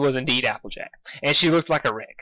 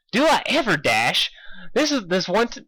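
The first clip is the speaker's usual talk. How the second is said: Pinkie voice; 6 semitones higher than usual. A narrator's reading.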